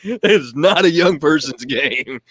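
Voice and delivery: slow, laughing through the words; amused, drawn-out laughing denial. Real, not acted.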